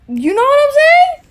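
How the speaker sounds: loud, high outraged shriek; mock outraged shriek; exasperated squeal. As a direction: squeal it in disbelief. Exasperated rant climax.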